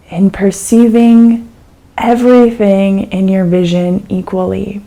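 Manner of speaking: calm, unhurried meditation guide with long pauses; guided meditation. Acted. Teacher guiding a listener through an eyes-open meditation, calm and unhurried.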